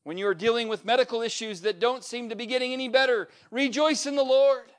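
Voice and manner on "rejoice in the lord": ordinary talk, nothing marked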